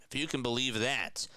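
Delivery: usual speaking voice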